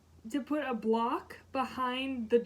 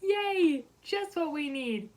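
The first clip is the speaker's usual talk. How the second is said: sarcastic cheer; 6 semitones higher, 3 dB louder, slower than usual. Retold or imitated. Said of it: "someone had said".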